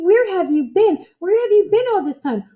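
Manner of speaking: high, loud, thrilled question; excited cry. A narrator's reading. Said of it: "cried Orvie".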